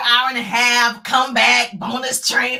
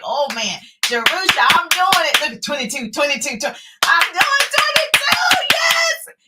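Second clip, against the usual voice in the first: excited; 5 semitones higher, 3 dB louder than usual. Shown as a demonstration. Coach showing followers how to get excited about the challenge, excited. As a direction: work yourself up into a jubilant high shout. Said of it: "That's how you get excited".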